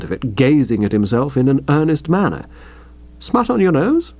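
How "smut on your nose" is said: put-on lightly polite young man asking; a mild, courteous query. A narrator's reading. Bertie politely asking his uncle, courteous. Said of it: "I asked courteously".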